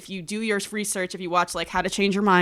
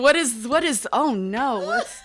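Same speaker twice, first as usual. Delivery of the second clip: mock scolding; 4 semitones higher, 6 dB louder than usual. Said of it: "I'd be like".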